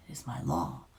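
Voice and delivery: flat, soft, unmoved; toneless. A narrator's reading. Witch answering the beast tonelessly. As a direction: state it without any inflection. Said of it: "she answered tonelessly".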